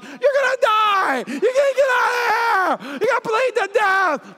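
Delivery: hammed-up hysterical in-law crying out; panicked mother-in-law. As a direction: shriek like a terrified mother-in-law. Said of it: "she was like".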